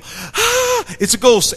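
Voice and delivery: sudden high shriek of fear; hammed-up terrified cry; mock scream of fright. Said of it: "and they go".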